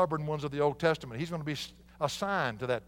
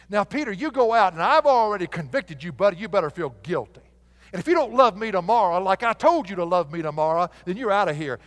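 louder mock scolding Jesus; 3 semitones higher, 8 dB louder than usual. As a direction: wag a finger at Peter in mock reproach. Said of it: "Is that what he said".

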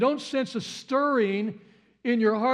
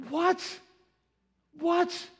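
incredulous what; 8 semitones higher than usual. Acted. Preacher repeats shocked question.

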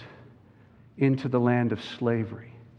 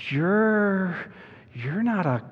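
put-on hesitant, halting girl's voice; understated fearful stammer; shaky-voiced Susan; 6 semitones higher, slower than usual. A narrator's reading. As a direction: falter and break off before the dreaded word. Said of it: "asked Susan in a shaky voice".

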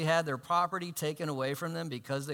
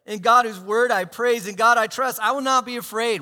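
louder and higher, rising praise; joyful praise; 4 semitones higher, 8 dB louder than usual. Retold or imitated. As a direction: proclaim it with joy. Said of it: "It's an amazing gift".

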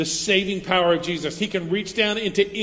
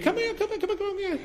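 put-on very high sing-song coaxing to a toddler; high cooing "come here, come here"; coaxing parent; faster than usual. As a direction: call a baby over in a high cooing voice.

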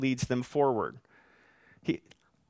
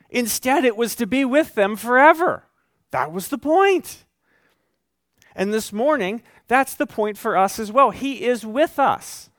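high, loud, impassioned preaching; preaching at full force; 6 semitones higher, 4 dB louder than usual. Acted. Pastor rousing the congregation with loud, impassioned preaching. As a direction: drive the promise home to the congregation.

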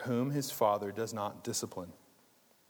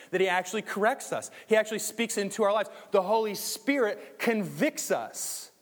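higher, louder preaching push; preaching at full force; 6 semitones higher, 8 dB louder than usual. Acted. Pastor preaching to his congregation, full force. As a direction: drive the point home to the church.

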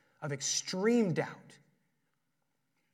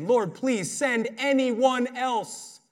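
slow, high, loud begging plea; desperate pleading; 8 semitones higher, 8 dB louder, much slower than usual. Retold or imitated. Moses begging God to send someone else, slow high plea. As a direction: drag out a last desperate plea. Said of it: "But Moses again pleaded".

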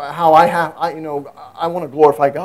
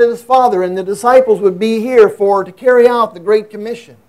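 higher, louder preaching push; impassioned preaching; 5 semitones higher, 6 dB louder than usual. Acted. Pastor urging his congregation, in full-force preaching. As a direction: preach it with rising fervor.

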